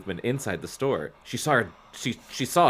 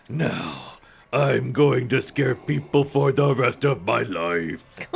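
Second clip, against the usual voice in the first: slow goofy monster voice; drawn-out silly monster lament; dumb monster voice; slower than usual. Acted. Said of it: "this dumb monster voice".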